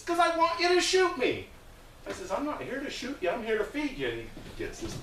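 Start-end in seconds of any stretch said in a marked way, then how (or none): 0.0-1.5 high, loud insistent shout; screaming man. Retold or imitated. Man demanding it of the volunteer, screaming. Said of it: "this guy's screaming". 2.3-4.1 high-pitched retold protest; pleading reply. Volunteer answering the man who wants shooting, pleading.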